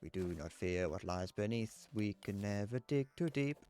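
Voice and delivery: slow, solemn recital of the verses; slow poem recitation. Acted. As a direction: read it like a grave dwarven ballad, slowly.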